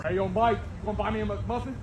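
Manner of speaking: ordinary talk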